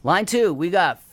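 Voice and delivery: upbeat radio host plug; radio announcer. Acted. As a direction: sell it like a radio ad.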